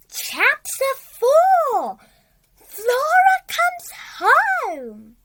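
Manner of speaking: high, slow, grand chapter announcement; announcer voice. A narrator's reading. Narrator to listeners, announcing the chapter grandly. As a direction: announce the new chapter like a herald.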